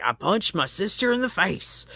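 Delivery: imitating a classmate. Quotes a woman in the DV class.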